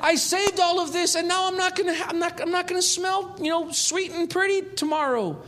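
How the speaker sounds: hammed-up high fretful woman; fretting Mary. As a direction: whine high about losing the costly perfume. Mock Mary fretting over perfume.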